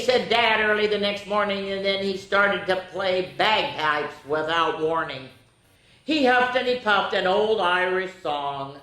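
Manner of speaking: lively read-aloud; story narration. A narrator's reading. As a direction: tell it like a picture book to kids.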